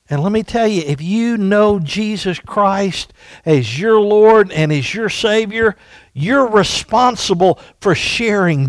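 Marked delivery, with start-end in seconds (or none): none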